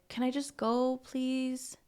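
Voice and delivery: small, soft voice asking a favour; mild pleading. Retold or imitated. Riley asks Mia to let him go, mildly pleading.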